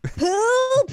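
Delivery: high, loud echo; echoed kid cry. Retold or imitated. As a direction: join the child's shout.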